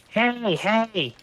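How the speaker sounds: high, bright calling out to the hunters; eager hey. Acted. Kobold runs over to the hunters, calling out eagerly. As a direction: wave them down with an eager call.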